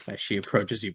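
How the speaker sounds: ordinary talk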